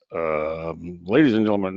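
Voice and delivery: a light put-on captain cabin announcement, smooth and unhurried; airline captain PA voice. Captain addressing passengers over the PA, lightly mock-smooth. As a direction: play the captain easing onto the PA mic. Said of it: "in my airline captain P A voice".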